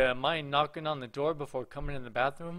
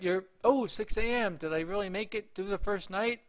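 higher, excited disbelief; amazed; 7 semitones higher, 4 dB louder than usual. Real, not acted. Player making it through the first night, amazed. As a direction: exclaim at the unexpected win.

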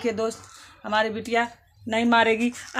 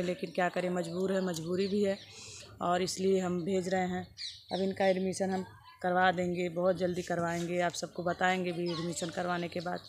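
sad, subdued; 5 semitones lower, 7 dB quieter than usual. Real, not acted. Mother sadly tells viewers she must send her daughter away. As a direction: lower and softer, heavy-hearted.

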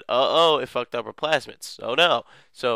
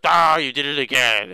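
louder, slightly higher annoyed cry; mock frustrated villain; 4 semitones higher, 8 dB louder than usual. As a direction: groan it loud in mock anger. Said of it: "he was like".

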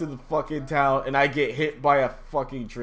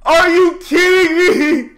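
much higher, louder and slow; drawn-out outraged shout; 12 dB louder than usual. Real, not acted. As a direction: stretch it out in disbelief. Raging at the game.

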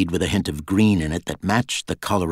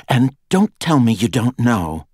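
raised pitch, louder insistence; demanding; 6 semitones higher, 6 dB louder than usual. A narrator's reading. Girl warning her guards off excuses, demanding. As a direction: push hard, higher and louder. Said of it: "she demanded".

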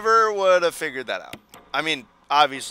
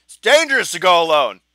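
loud, higher quoted game line; hammed-up hero quest line; mock game quote; 4 semitones higher, 9 dB louder than usual. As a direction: boom out the classic game quote. Quoting famous game line.

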